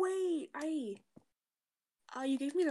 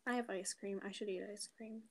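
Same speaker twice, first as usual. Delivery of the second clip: soft ASMR; 8 dB quieter than usual. Pretend. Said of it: "nice A S M R there".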